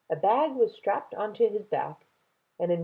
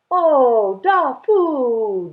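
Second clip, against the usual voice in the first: emotional cry; 8 semitones higher, 10 dB louder, much slower than usual. Acted. Grandfather hugging twins.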